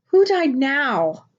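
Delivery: put-on slow, drawn-out, exasperated child; frustrated huff. A narrator's reading. Jeremiah hearing of another death, in a slow frustrated huff. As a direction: let the weariness drag every word. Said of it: "asked Jeremiah in a frustrated huff".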